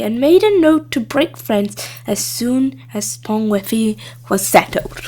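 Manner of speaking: usual speaking voice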